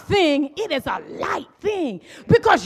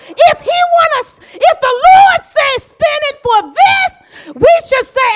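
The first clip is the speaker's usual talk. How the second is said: full-force preaching; 7 semitones higher than usual. Acted. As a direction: build the congregation up to a roar.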